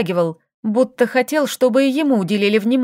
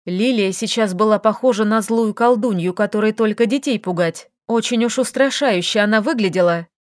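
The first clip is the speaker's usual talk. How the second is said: usual speaking voice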